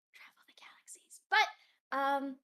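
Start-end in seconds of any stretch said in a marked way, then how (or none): 0.1-1.2 secretive whisper. Acted. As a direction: breathe it like a secret.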